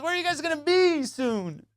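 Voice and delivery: high-pitched pleading question; mock desperate plea. Buddy asking officers their whereabouts, mock desperate.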